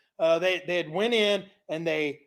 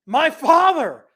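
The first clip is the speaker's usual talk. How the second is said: high, loud, eager pleading; eager kid; 8 semitones higher, 6 dB louder than usual. Acted. King of Israel asking Elisha, high and loud with eagerness. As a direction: beg like a kid in a candy store. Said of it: "like a little kid in a candy store".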